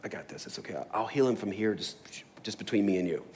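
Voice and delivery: breathy conspiratorial whisper. Pretend. Jesus whispering reassurance to the centurion, whispered. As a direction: whisper it like a secret between two. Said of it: "maybe whispered".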